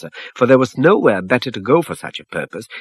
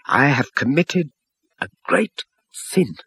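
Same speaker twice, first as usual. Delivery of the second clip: trailing off; 6 semitones higher, slower than usual. A narrator's reading. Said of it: "The man's words trailed off".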